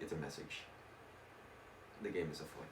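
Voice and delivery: low, hushed detective voice; Sherlock Holmes. Acted. Sherlock answers the inspector, low and soft. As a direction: drop low and quiet, gravely knowing.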